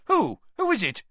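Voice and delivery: who could it possibly be; highly disbelieving. A narrator's reading. Crouch demands of Diggory who was caught, disbelieving. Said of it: "sounding highly disbelieving".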